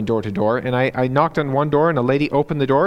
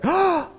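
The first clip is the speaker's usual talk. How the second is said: sharp, high imitated gasp; shocked gasp. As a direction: gasp "Ah!" as if startled by what you see. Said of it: "she gasped".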